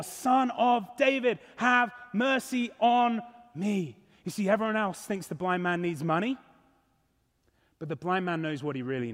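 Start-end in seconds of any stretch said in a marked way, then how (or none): 0.0-4.0 slow, drawn-out cry for mercy; crying out more. Acted. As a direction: stretch each word into an insistent plea. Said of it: "cries out all the more".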